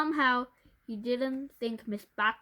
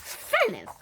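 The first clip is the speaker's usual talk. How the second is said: sharp high command; teacher silencing the class with a high sharp order; 12 semitones higher, 5 dB louder than usual. A narrator's reading. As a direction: bark it at the class. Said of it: "ordered Miss Patlax".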